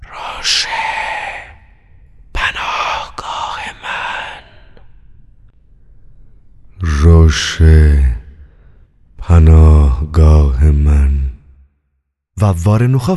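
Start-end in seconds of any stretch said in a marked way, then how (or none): 0.0-4.4 whispered, far-off and faint; a ghostly voice whispering down the line; hushed echo from afar; distant whisper. A narrator's reading. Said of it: "صدای بمی از دوردست". 6.8-11.3 lower, louder and very slow; a deep voice booming from far off; slow resonant chant from afar; deep distant voice. A narrator's reading. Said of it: "صدای بمی از دوردست".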